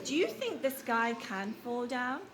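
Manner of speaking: normal talking voice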